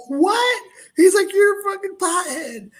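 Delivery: disbelieving dad exploding; incredulous outburst. Retold or imitated. Dad's shocked outburst.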